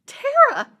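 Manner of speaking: very high, loud disbelieving cry of the name; astonished cry. Retold or imitated. Recognizing her shy student now a colonel, loud astonished cry. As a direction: shriek the name in shock.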